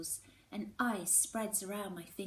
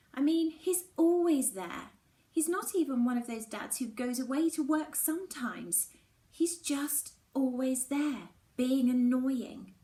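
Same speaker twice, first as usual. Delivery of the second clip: upset; 6 semitones higher, 8 dB louder than usual. A narrator's reading. Mallory upset her dad has left. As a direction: push through it, emotion rising.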